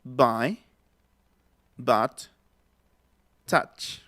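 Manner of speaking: each word slow on its own, same middle vowel; model vowel. Shown as a demonstration. Teacher drills students on the middle vowel in by, but, touch. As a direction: say by, but, touch with pauses between. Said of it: "Otra vez".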